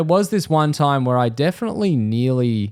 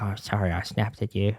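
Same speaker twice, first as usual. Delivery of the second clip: quiet apology; 3 semitones lower, 9 dB quieter than usual. Retold or imitated. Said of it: "He's like".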